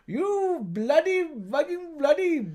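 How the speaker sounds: imitated Indian-accented tirade; Aussie Indian accent. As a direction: curse in a hammed-up Indian accent. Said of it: "two Aussie Indian guys constantly screaming at each other".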